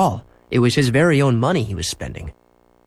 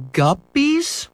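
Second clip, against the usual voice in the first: high, puzzled question; mother not understanding. A narrator's reading. Mother puzzled by the word guppies.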